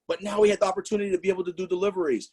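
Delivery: ordinary talk, nothing marked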